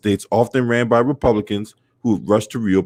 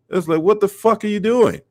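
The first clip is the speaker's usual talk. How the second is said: exasperated disbelief; 9 semitones higher than usual. Real, not acted. Scolding the crowd at church, high and incredulous. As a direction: pitch jumps high in disbelief. Outraged at crowds ignoring pandemic.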